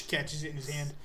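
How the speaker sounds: ordinary talk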